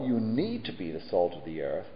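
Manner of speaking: "need" leaned on with a rising tune, as a wrong version; stress on need. Shown as a demonstration. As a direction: hit "need" and let it rise. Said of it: "Jesus didn't say".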